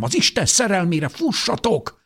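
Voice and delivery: high-pitched urgent plea to flee; desperate cry. A narrator's reading. Mother begs her children to flee, desperate cry. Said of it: "kiáltotta anyám".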